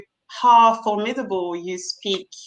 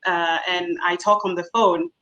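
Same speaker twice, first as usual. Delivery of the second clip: usual speaking voice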